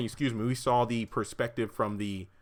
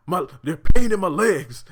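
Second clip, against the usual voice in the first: loud, high imitation of agony; mock pained yell; 7 semitones higher, 13 dB louder than usual. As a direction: yell like a hurt patient, hammed up. Acting out the injured David.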